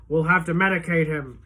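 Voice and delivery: a cop pinning him down, calling it. A narrator's reading.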